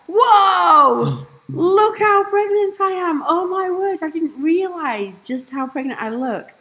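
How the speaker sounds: high, loud startled exclaiming; shocked. Retold or imitated. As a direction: gasp at your reflection. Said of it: "I was just like".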